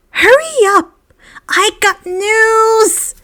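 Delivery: high, loud call, last words slowed right down. A narrator's reading. Colin calls to the group as he rushes past, high and loud. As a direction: shout it across the room, eager. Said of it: "Colin Creevey called".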